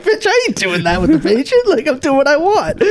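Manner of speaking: high-pitched comic outrage; indignant retort. Retold or imitated. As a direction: fire back in mock indignation. Acted indignant retort to the therapist.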